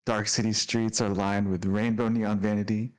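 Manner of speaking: ordinary talk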